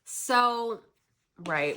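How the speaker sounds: ordinary talk, nothing marked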